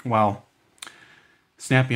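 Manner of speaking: ordinary talk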